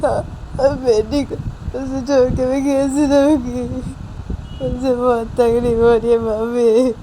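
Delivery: long tearful wailing complaint; acted crying. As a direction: plead through mock sobs that nobody understands. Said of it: "क्या बच्चों की तरह कर रही हो". (रोते-बिलखते हुए शिकायत)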